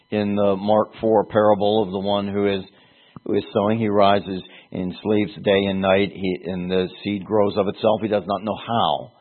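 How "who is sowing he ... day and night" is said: usual speaking voice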